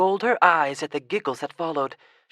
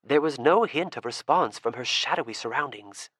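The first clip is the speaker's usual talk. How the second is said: ordinary delivery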